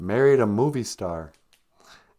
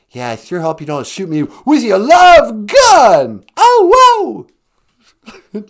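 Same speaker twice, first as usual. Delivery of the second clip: much louder, higher rock-star yell; 12 semitones higher, 15 dB louder, slower than usual. Retold or imitated. Rock star cheering on stage, loud high yell. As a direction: belt it out like a rock frontman. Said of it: "[name], ladies and gentlemen".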